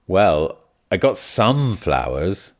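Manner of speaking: "some" drawn out slowly with heavy stress; hesitant answer, leaning long on "some". Shown as a demonstration. Answering about flowers for Jimmy, heavy stress on "some". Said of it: "when you're emphasizing some like here".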